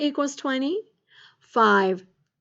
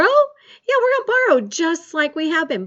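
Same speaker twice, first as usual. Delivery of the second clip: high, loud eager yes; excited yes; 6 semitones higher, 6 dB louder than usual. Real, not acted. Teacher answering a pupil's question, eager and loud. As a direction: burst out with enthusiasm.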